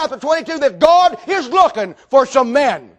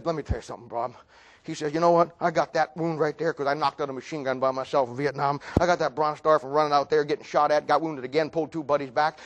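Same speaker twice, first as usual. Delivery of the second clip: soft, low veteran's account; low-key confiding; 8 semitones lower, 11 dB quieter than usual. Retold or imitated. Sarge explaining his medals to the preacher, low-key confiding. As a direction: confide it quietly and deep.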